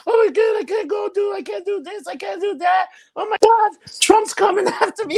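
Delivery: high, shouted mock panic; panicked whiner. Mocking people scared of Trump, a high shouted hysterical whine. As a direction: ham up a hysterical scared person. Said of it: "these people are like".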